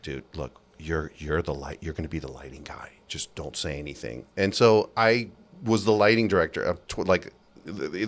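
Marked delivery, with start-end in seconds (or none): 0.0-4.3 low, near-whispered instruction; hushed confiding boss. Retold or imitated. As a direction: keep it down, like letting someone in on a secret. Boss confides a cover-up to him.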